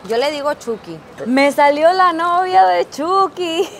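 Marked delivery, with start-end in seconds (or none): 1.2-3.7 higher, louder and slow, savoring the prize; thrilled. Real, not acted. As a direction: announce the prize with real delight. Thrilled at her capsule prize.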